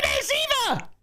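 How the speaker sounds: high excited shout of the name. Real, not acted. Streamer spotting a mod's animation, thrilled high shout. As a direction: yell it out, thrilled to recognise her. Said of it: "Sorry for shouting".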